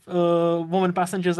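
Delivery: usual speaking voice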